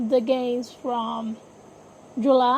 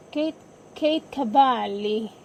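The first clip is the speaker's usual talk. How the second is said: Kate Cavalli with an Italian accent, the k sounds aspirated and Cavalli said as ka-VAH-lee; slipping into Italian accent. Real, not acted. Podcaster reading a draft pick's name, Italian accent. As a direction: roll the name out the Italian way, slowly. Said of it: "my Italian accent came out".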